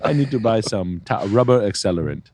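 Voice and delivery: put-on Kenyan accent; mock Kenyan accent. Joking caller listing purchases for a fire, mock Kenyan accent. Hammed-up Kenyan money-scam bit.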